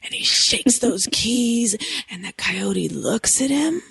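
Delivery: slow, hushed spooky build; campfire storytelling. Pretend. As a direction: lean in and slow down like a ghost story. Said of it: "tell it like how people tell campfire stories".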